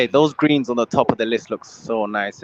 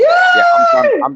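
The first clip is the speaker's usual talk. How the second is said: shout; 9 dB louder than usual. Real, not acted. Vows to quit football.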